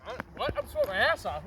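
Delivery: incredulous high what; surprised. Real, not acted. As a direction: caught off guard, disbelieving. Disbelief at being called cold.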